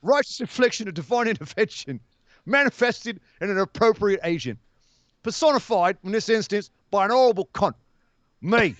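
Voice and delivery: Cockney villain's speech in short phrases; Cockney accent. Acted. Quoting a nemesis definition to co-host in Cockney. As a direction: deliver it phrase by phrase in put-on Cockney. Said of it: "Cockney accent".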